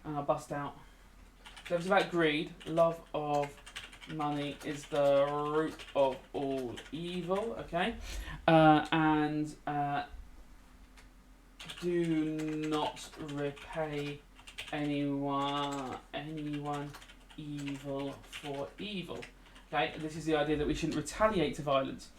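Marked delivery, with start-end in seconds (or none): 2.7-7.6 slow, drawn-out dictation pace. Shown as a demonstration. Teacher dictating a quote to the class while writing it, slowly. 11.8-19.2 slow, softer dictated quote; slow soft dictation. Shown as a demonstration. Teacher dictating a Bible quote while writing, slow and soft.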